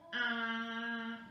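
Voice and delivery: "Un" drawn out on a steady note; held target sound. Shown as a demonstration. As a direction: sustain "Un" so pupils hear the sound. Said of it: "notre signe pour le un". (« Un » étiré, son tenu)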